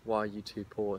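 usual speaking voice

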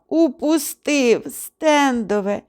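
slow, high, lightly sobbed lament; mock sobbing. A narrator's reading. Soaked hunter sobs over his lost shotgun, lightly hammed. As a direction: wail over the lost gun. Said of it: "проридав".

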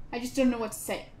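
normal talking voice

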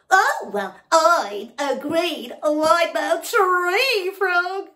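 high, loud, slow booming boast; frog shouting. Acted. Noisy frog shouting his name at the kangaroo. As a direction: bellow the name proudly. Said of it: "shouted the frog".

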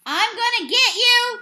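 high, loud threatening yell; second baseman yelling at the runner. A narrator's reading. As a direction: yell it while chasing. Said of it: "the second baseman yelled".

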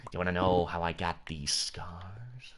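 slow, low whispered villain; Heath Ledger. Acted. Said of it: "Do the Heath Ledger voice".

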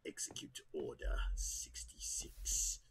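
low, slow raspy whispered command; Palpatine whisper. Acted. Gamer playing Palpatine giving the order, whispered low. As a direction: hiss the order like a dark lord. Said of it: "You sound like Palpy".

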